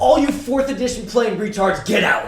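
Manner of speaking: loud, high laughing tirade; angry rant. Retold or imitated. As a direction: throw them all out, half laughing. Hitler raging at his players.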